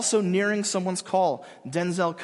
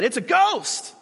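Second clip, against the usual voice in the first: terrified cry; 8 semitones higher, 6 dB louder, slower than usual. Retold or imitated. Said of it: "they were terrified and said".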